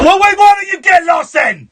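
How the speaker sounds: high, loud telling-off; exasperated shout. Real, not acted. Host dismissing a rambling caller, exasperated shout. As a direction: blow up at the time-waster.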